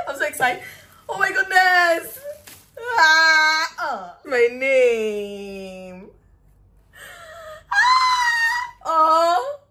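high loud tearful shouting; overwhelmed, shouting through tears; crying with joy. Real, not acted. Creator seeing her name on the award, crying out for joy.

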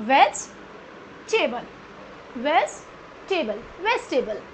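Vegetable slowly split, "ves - tay-bul"; correct pronunciation. Shown as a demonstration. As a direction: break Vegetable after "vej", then rejoin, falling. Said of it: "क्या होता है?". (Vegetable को "वेज-टेबल" तोड़कर बोलें)

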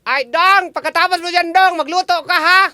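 high calling mother. Acted. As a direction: call out like a mom. Host voices mother in skit.

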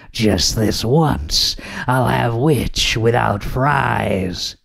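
slow, menacing dragon voice; mean dragon. A narrator's reading. Dragon threatening to eat the witch, slow menace. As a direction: draw it out like a hungry, menacing dragon.

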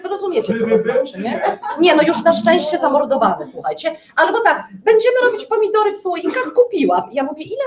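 ordinary talk, nothing marked

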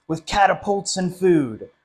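ordinary delivery, nothing marked

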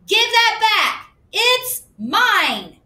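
put-on high, loud, slow child's demand; upset girl. A narrator's reading. Susie grabbing back her sketchbook, high and pouty. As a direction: stretch it out like a pouty kid.

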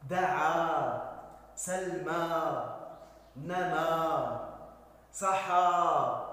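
each word said very slowly and clearly, one at a time; slow dictation. Shown as a demonstration. Teacher reading a word list to pupils, slow dictation. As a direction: read the word list out slowly for children to repeat. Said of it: "سنتدرب على ذلك".

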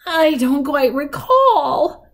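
slow, high, wavering-memory granny; old woman. A narrator's reading. Granny trying to recall what's lost, slow old-woman voice. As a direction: search your memory slowly, as an old woman. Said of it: "said the old woman".

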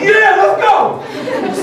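very high, slow, laughing cheer; excited. Retold or imitated. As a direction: drag it out with glee. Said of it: "I was like".

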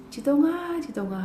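first name called out high and loud, then dropping; calling out. Retold or imitated. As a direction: call a child from another room. Mom calls her son by name.

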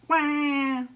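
one high, loud imitated wail; baby seal cry. A narrator's reading. Baby seal following Ebb, high wail. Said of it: "answered the baby seal".

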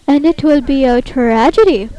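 loud, swooping tragic declaration; mock theatrical. Player imagines the caller overhearing, loud mock-tragic. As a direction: proclaim it grandly, hammed-up. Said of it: "talking like this".

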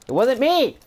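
high, insistent protest of innocence; defensive call across the house; shouted denial. Real, not acted. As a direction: holler the blame onto someone else. Calls out denial to parent.

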